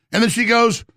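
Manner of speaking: ordinary talk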